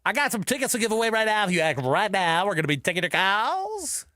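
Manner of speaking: fast, high, loud hype DJ; radio DJ voice. Shown as a demonstration. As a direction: hype it up like a morning-show jock. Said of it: "make me sound like a radio D J".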